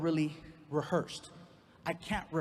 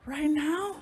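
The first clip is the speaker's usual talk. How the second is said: put-on high, loud surprised girlfriend; a hint of a startled girlfriend; girlfriend's voice; 9 semitones higher, 5 dB louder than usual. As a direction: squeak it out in disbelief. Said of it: "girlfriend answers".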